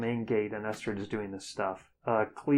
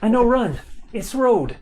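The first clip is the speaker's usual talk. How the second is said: high, loud shout to flee; urgent yell; 12 semitones higher, 8 dB louder than usual. Acted. Hero shouts at Clebe to flee, loud and urgent. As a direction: yell at them to run.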